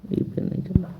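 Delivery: muttered aside. Real, not acted. Muttered aside about the pen.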